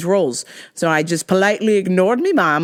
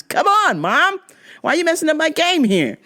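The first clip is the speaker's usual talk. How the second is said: whiny complaint; 8 semitones higher than usual. Retold or imitated. Son complaining to mom.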